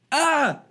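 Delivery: high exasperated ah; real exasperated shout; frustrated cry. Real, not acted. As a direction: let out a fed-up yelp. Frustrated outburst.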